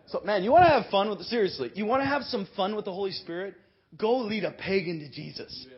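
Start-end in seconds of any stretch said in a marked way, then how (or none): none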